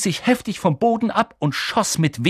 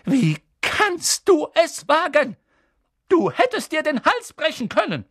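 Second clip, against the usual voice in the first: furious; 5 dB louder than usual. Acted. McGonagall scolding Harry, furious and high. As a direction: scold him in outrage. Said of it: "funkelten zornig".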